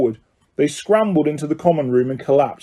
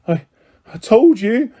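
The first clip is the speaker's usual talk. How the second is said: breathless gasp, pitch up; 6 semitones higher than usual. Acted. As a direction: gasp it out, winded. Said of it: "Hermione gasped".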